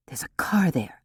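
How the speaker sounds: voiceless whisper; hissed whisper. A narrator's reading. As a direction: whisper it sharply, creeping closer. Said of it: "Theodosia hissed".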